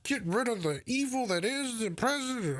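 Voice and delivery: sneering parody of a politician; mock candidate voice. Mocking a candidate's pitch, in a high put-on voice.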